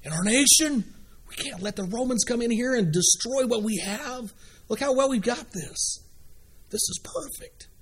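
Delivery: high-pitched worried objection; selfish Pharisee. Acted. Pharisee objecting to Jesus making changes, high-pitched worried protest. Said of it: "my position as a Pharisee".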